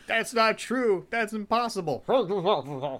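high, drawn-out mock anguish; hammed-up film-scene despair; mock movie dialogue. Cohost acting out Star Wars lines, high mock anguish. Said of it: "to hear Darth Vader go".

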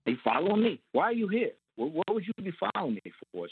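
a mobster caught off guard, pushing back; defensive. Retold or imitated. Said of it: "he just went completely, like, defensive".

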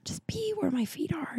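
soft, intimate whisper to herself. Real, not acted. A host tells her listeners about being present, in a soft whisper. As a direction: say it like a quiet personal mantra. Sincere closing reflection.

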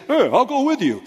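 mock guy voice. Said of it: "guys standing up saying".

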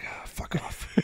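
whispered, defeated comeback under the breath; muttered retort. Retold or imitated. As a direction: toss it off in a faint whisper. Muttered comeback in a retold story.

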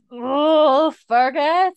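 high, loud imitation of a labouring wife; mock labour cry. Wife in labour calls to Fergus, mock cry. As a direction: groan out the name, hammed up.

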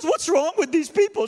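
high, loud tearful wail, imitated; emotional outburst. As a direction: wail it as the overwrought cleaning lady. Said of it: "I'm sorry to be emotional".

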